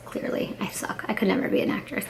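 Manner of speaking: whispered put-down of herself, under her breath; self-mocking whisper. Real, not acted. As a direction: drop to a whisper to knock yourself. Self-deprecating aside about acting.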